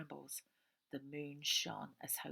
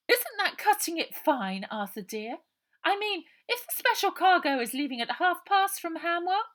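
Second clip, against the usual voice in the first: high, loud anxious lady's voice; a fretful woman fussing at her husband; fussy Mrs Buller; 13 semitones higher, 13 dB louder than usual. A narrator's reading. Mrs Buller fretting at Arthur, high and loud.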